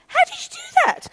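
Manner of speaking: very high mock astonishment; amazed question. Onlooker amazed by son's photo trick, astonished squeak. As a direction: squeak it out in exaggerated wonder.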